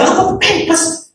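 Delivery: high slow puzzled ask; mock puzzled query; confused question. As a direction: ask as if mishearing. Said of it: "Pak Dwi kan agak-agak tidur gitu".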